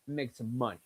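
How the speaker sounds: normal talking voice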